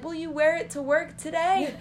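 put-on high, hopeful child's question; a kid begging dad sweetly; little girl asking dad to wear the necklace, eager.

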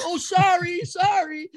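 very high, hammed-up apology; flustered apology. Scolded husband giving up the phone, mock apologetic. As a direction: imitate a husband caught out and backing off.

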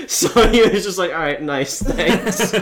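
chuckling as he speaks; laughing. Real, not acted. Friend laughing while retelling the pale guy's reply.

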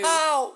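high, loud yelp of pain; pained cry. Acted. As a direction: yelp as if struck.

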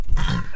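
sharp throat clear; coughing. Real, not acted. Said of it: "keep coughing here a little bit".

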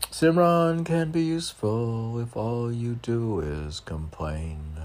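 rhythmic, lifted poetry reading; poem recitation. Acted. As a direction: declaim each line like a spiritual verse. Reads a poem aloud.

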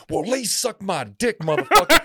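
hammed-up, high-pitched insult; angry homeless guy. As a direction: imitate a belligerent street guy, punching the last word. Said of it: "And he's like".

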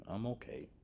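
fading self-reassurance. Real, not acted. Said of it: "I'm okay with changing it up".

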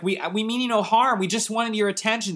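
a small gnome trying to calm them; gnome Chip. Acted. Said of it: "Chip's like".

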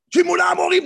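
"Shimona Amorim" read high and loud, like an angry outburst. Shown as a demonstration. Teacher acts out the wrong, angry reading of Moses. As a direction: bark "Shimona Amorim" as a furious rebuke. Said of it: "non, c'est pas comme ça qu'il faut lire".

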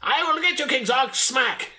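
very high, cartoon battle cry; video game heroine. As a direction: squeak it like a tiny game hero.

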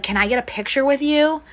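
high, excited request; eager fan. Retold or imitated. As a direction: beg for the photo like a thrilled fan. Fan asks for a photo.

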